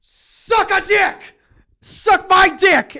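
very high, slow, loud shout; raging gamer yell. Retold or imitated. Roommate dying in Halo, very high drawn-out shout. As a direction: scream it right after dying. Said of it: "he died and just went".

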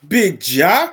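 high, loud burst of hype; excited hype call. Real, not acted. Hypes up the named player.

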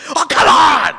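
high, loud, exasperated groan of a line; fed-up outburst. Retold or imitated. Comic venting, fed up with the movie. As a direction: throw up your hands in disgust.

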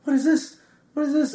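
high-pitched, repeated excited demand; imitated excited amazement. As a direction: mimic someone thrilled by a new track. Retelling DJ's excited reaction to music.

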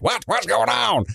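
high, alarmed confusion; panicked Gary. Acted. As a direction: squeal it out in panic.

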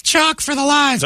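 slow, high singsong falsetto; innocent softball girl. Acted. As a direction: answer sweetly in a little-girl squeak. Girl answers security question.

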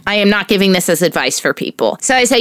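usual speaking voice

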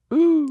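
high, loud owl hooting; very high-pitched imitation owl call. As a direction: hoot like an owl. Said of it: "Sound like owls".

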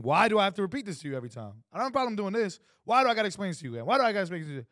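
heavier demanding. Shown as a demonstration. To his wife, fed up with repeating himself. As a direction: press it like an accusation. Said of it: "This feels so much heavier and stronger".

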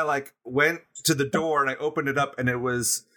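normal talking voice

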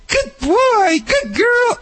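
put-on very high, sing-song good boy; like a surfer boy cooing at a puppy; high-pitched praise. Shown as a demonstration. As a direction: praise the dog in a squeaky high voice. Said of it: "with the high pitch".

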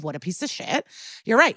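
ordinary talk, nothing marked

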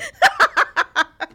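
loud shrieking laugh; helpless high cackle; high squealing laugh. Real, not acted. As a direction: burst into high-pitched laughter. Laughing at punchline.

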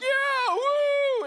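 put-on very high, loud kid's squeal; cheering kid. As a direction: squeal it like a hyped-up twelve-year-old. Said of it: "he sounds exactly the same".

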